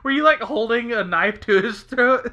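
high, loud laughing question; laughing hard. Real, not acted. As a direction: squeal through the laugh.

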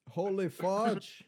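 put-on slow, high Scottish-accent Holy farch; mock Scottish. Joking about a Scottish curse, mock Scottish. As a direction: ham up a Scottish exclamation. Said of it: "something a Scottishman would say".

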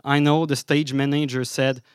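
usual speaking voice

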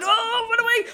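high, loud alarm; mock panic. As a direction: sound the alarm in hammed-up terror. Pretend flight from a hobgoblin.

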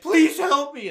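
high, loud begging cry; acted-out panicked plea; imitated desperate plea. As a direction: plead as if about to die. Retells dying man begging.

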